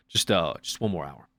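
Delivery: lightly voiced low, weary cashier; night-store small talk. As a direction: mumble it low like a night-shift worker. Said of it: "when I go to the store at night".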